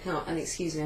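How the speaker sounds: ordinary talk, nothing marked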